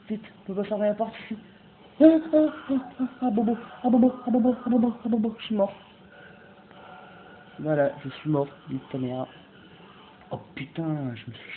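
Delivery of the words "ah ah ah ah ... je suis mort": high, loud, babbled yelps; panicked cries of pain. Real, not acted. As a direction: yelp repeatedly as the hits land. Character hurt and dying. (cris de douleur paniqués, aigus)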